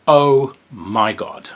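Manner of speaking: slow delighted exclamation; slow gleeful gasp. Real, not acted. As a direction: savour it slowly. Reacting to gory content.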